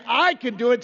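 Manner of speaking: normal talking voice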